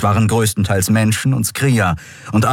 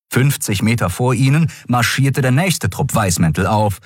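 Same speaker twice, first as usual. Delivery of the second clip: ordinary delivery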